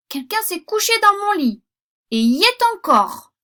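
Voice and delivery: put-on very high, very loud baby bear squeal; little bear crying out there's someone in his bed; shrieking high baby-bear alarm; tiny bear voice. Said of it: "dit le tout petit ours de sa toute petite voix".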